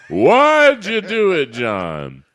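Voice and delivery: high, loud drawn-out taunt; hammed-up cry to the ghost; stretched high taunting call; mock taunt. Replaying the taunt.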